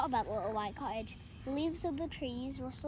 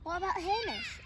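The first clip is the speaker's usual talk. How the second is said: raised pitch, a bit eager; wife asking hopefully, higher voice; 8 semitones higher than usual. Acted. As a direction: ask it brightly, higher up. Missus Grinling asks.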